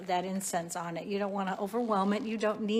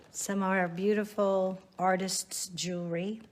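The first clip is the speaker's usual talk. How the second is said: ordinary delivery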